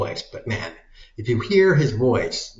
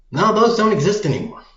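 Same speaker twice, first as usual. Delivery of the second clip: gleeful outburst; 10 semitones higher, 7 dB louder, faster than usual. Acted. Gleeful nuclear threat rant.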